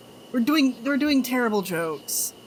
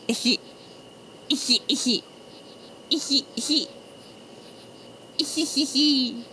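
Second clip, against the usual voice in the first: impish giggle; 2 semitones higher than usual. Real, not acted. Streamer pleased with own pee joke, impish giggling. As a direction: giggle in little repeated squeaks at your own pun.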